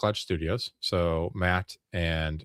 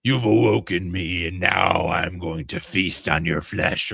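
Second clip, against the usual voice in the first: menacing demon; 2 semitones lower, slower than usual. Retold or imitated. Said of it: "the demon, voiced by GM".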